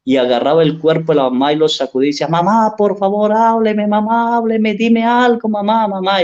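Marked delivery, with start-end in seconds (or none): 2.3-6.2 high-pitched imitated pleading to a dead mother; retold crying plea. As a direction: voice the daughter begging her mother to speak, pitched up. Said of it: "y lloraba y clamaba". (súplica llorosa imitada, aguda)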